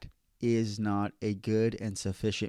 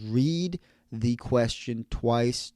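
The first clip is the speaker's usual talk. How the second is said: very slow, with "read" drawn out; emphatic instruction; much slower than usual. Real, not acted. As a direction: hammer home each word slowly. Repeats key rule for emphasis.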